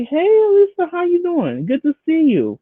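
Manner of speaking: high, sing-song hello, held up high then swooping; friendly greeting. Acted. Guest walking in to win over the assistant, gushy friendly greeting. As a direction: greet her in an exaggeratedly sweet, high voice.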